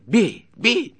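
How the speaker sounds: loud cries leaping very high; shrieked, furious challenge; ferocious voice. A narrator's reading. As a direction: dare him wildly to strike. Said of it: "мужик свирепым голосом".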